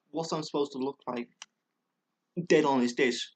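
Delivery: usual speaking voice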